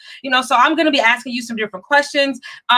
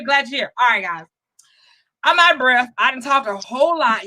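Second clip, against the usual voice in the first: normal talking voice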